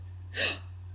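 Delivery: sharp, sudden gasp; shocked gasp. A narrator's reading. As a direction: gasp in alarm. Jack discovers the army ants.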